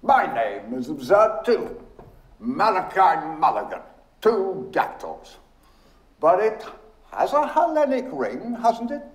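high, lilting, playful chatter. A narrator's reading. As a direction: lift it high and merry, teasing.

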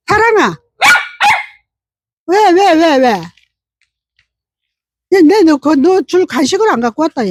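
high, playful cooing to a pet. Real, not acted. Owner telling her dog she forgot its treat, playful high baby talk. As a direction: fuss sweetly over the dog.

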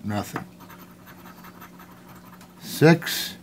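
ordinary talk

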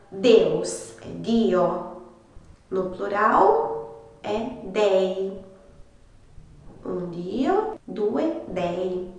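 "Dio" and "Dei" said very slowly; very slow model. Shown as a demonstration. Teacher slowly dictates the Italian words to students. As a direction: spell it out slowly for beginners. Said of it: "teacher models singular and plural".

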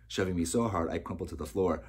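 ordinary delivery

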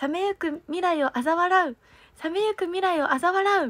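practising the troupe's song lyric with crisp articulation; diction drill recital. Shown as a demonstration. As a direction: declaim the lyric like a stage warm-up. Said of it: "それを滑舌よく言う練習".

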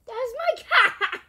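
high, loud and drawn out; slow, high-pitched laughing shout; loud exclamation. Real, not acted. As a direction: cry it out with a laugh. Sudden outburst about the cat.

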